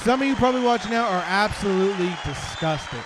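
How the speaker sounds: usual speaking voice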